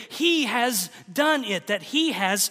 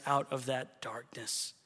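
much softer, lower, near-whispered sermon voice; hushed preaching; 6 semitones lower, 7 dB quieter than usual. Acted. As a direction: drop to a hush over the cross.

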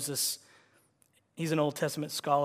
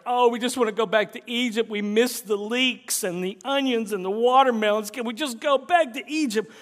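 whiny mocking complainers, higher; 5 semitones higher, 5 dB louder than usual. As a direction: mimic the whining crowd. Grumbling Israelites mimicked.

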